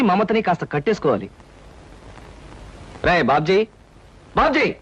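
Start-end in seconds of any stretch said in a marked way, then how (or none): 3.0-3.7 low-pitched call to a younger brother; low, stern calling-out; low summons. Acted. Older brother calls the younger, first take. 4.4-4.7 raised-pitch call to a younger brother; insistent call. Acted. Calls again after no answer.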